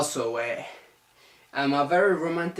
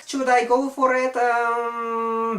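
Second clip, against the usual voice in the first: high, louder, held-out pitch; acted-out pondering; 6 semitones higher, 3 dB louder, slower than usual. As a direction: wonder aloud in a high voice, stretching the um. Acts out his past hesitant question.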